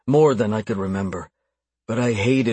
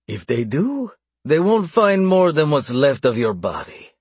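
menacing; 5 semitones higher than usual. A narrator's reading. Captor threatens bound captive.

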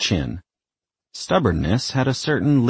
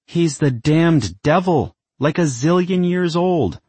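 exasperated; 11 semitones higher, 4 dB louder than usual. Acted. Isabel rants at her mother.

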